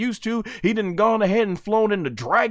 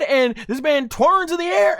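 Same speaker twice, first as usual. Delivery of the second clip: ordinary talk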